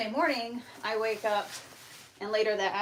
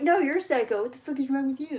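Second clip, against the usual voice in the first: higher, indignant throw-back; mock angry retort; 4 semitones higher, 5 dB louder, much faster than usual. Imitating the scammer arguing back, mock indignant. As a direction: imitate someone hurling the insult back. Other side's retort, acted.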